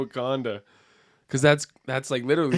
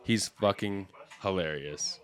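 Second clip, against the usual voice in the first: normal talking voice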